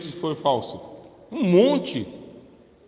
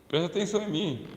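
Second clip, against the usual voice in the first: usual speaking voice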